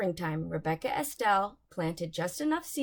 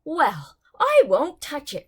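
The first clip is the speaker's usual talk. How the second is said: louder, stubborn refusal; insisting; 5 semitones higher, 8 dB louder than usual. Acted. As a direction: dig in your heels on every word. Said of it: "Rebecca Estelle insisted".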